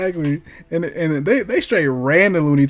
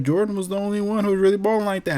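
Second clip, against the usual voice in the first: mocking people; 7 semitones higher, faster than usual. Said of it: "People like".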